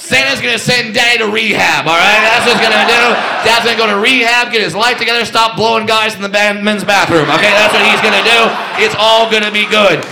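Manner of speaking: loud, shouting mock Santa promises; loud spiteful Santa; loud defiant promising.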